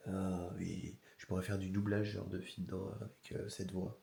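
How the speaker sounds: hoarse, cracking morning voice; rough voice, breaking up. Real, not acted. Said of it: "elle est rauque".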